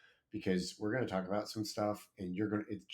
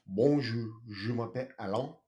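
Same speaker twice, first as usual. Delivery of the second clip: correcting French accent; 2 semitones higher, 4 dB louder than usual. Shown as a demonstration. Host corrects cohost with a proper French accent. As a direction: show her how the French really says it. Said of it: "That's how I say it".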